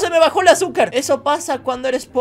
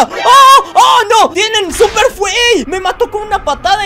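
much higher, louder alarmed yell; panicked shout; 10 semitones higher, 8 dB louder than usual. Real, not acted. Streamer losing the fight, shouts in alarm. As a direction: cry out as you get beaten.

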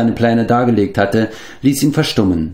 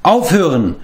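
called stop, higher and firm; calling out; 9 semitones higher than usual. A narrator's reading. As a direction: cut the quarrel short. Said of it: "rief Taran".

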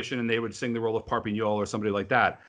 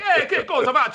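mocking chorus men; 6 dB louder than usual. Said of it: "they're like".

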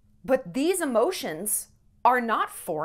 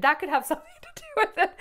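laughing; 7 semitones higher, much faster than usual. Real, not acted. Laughs through tears.

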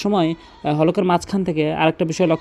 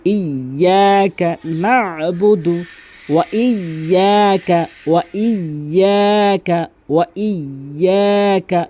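slow melodic tilawat; Quran recitation; 2 semitones higher, much slower than usual. Acted. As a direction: chant it with long held vowels.

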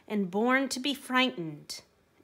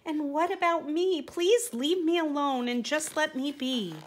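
higher, pleading little voice; frightened plea; 7 semitones higher than usual. Acted. Frightened donkey begging the men to leave him, high pleading. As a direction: beg to be left alone, a touch scared. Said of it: "The donkey was frightened".

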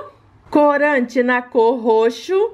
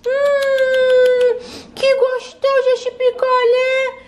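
put-on little kid voice; 11 semitones higher, much slower than usual. Child voice enjoying popsicle.

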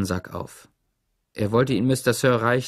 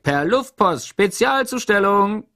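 raised, higher teasing call; mock call out; 9 semitones higher, 5 dB louder, slower than usual. A narrator's reading. Zickzack teases Stanley while throwing the sack, higher calling voice. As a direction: toss it out like a showman.

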